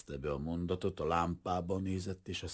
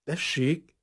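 uncertain; 10 semitones higher, 7 dB louder than usual. Acted. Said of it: "bizonytalan hangon mondta".

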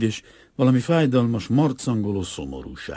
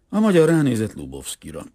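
usual speaking voice

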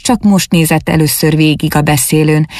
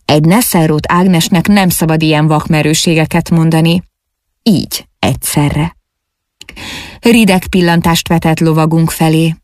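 ordinary delivery, nothing marked

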